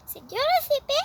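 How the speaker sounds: high timid question; small worried child. Acted. Little girl asking her mom, high and timid. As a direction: ask like a nervous little girl.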